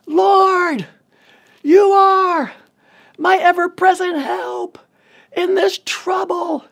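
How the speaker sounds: high, loud, drawn-out cry to God; sustained high shout, phrase by phrase; screamed prayer. Retold or imitated. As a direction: scream each phrase so a distant helper hears. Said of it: "I screamed them, hoping that my helper".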